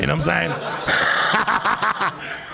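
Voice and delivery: very slow, dissolving into laughter; laughing aside. Real, not acted. Comic to audience, laughing at the stoned driver bit. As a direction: let the laugh swallow the words. Laughs at own bicycle story.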